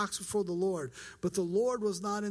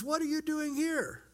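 higher-pitched challenge from God; confronting demand; 9 semitones higher than usual. Acted. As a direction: demand it as God catching a runaway. God suddenly confronts fleeing Elijah.

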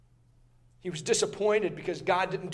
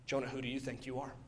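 much softer, lower and fast; quiet question; 8 semitones lower, 15 dB quieter, faster than usual. Retold or imitated. God asks Jonah, suddenly hushed and quick. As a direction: let it drop to a near hush. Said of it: "God answers the unasked question".

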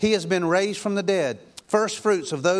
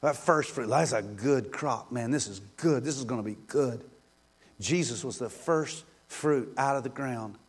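lower, softer, unhurried sermon voice; soft intimate preaching; 7 semitones lower, 6 dB quieter than usual. Acted. Preacher softly reflecting on Jesus' work. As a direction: draw the congregation in quietly.

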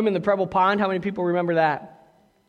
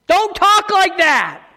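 loud, slow scolding yell; angry shout; 9 semitones higher, 7 dB louder, slower than usual. Retold or imitated. Preacher retelling how he yelled at a cursing friend, angry shout. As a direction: bark it at the friend who cursed.

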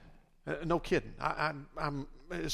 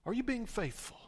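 soft self-questioning; 3 semitones lower, 4 dB quieter than usual. Retold or imitated. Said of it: "I had to ask myself this".

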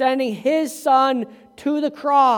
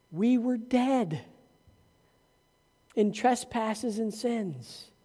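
"We were dead" softer and slow, almost hushed; hushed and solemn; 9 dB quieter, slower than usual. Acted. Hushed before the triumphant turn.

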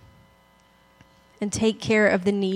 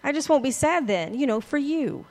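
sarcastic reply; 6 semitones higher than usual. Retold or imitated. Upset friend answering the comforter, high and sarcastic. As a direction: roll your eyes at the advice.

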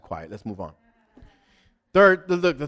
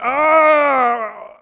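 high, loud whiny wail; hammed-up lament over still being single; mock self-pity; 14 semitones higher, 11 dB louder, slower than usual. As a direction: whine about your own luck. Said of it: "you're like".

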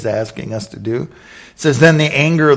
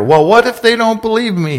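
high, louder anxious whine; worried whine; 9 semitones higher, 4 dB louder than usual. Acted. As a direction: fret it out in a high voice. Moses' worried question acted.

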